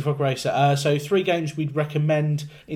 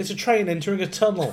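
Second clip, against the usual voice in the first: laughing; 7 semitones higher than usual. Real, not acted. Joking guesses, laughing.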